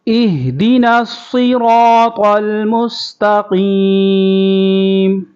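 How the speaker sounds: melodic teacher's tilawat; Quran recitation. Shown as a demonstration. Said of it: "صاد کو پر کرنا ہے".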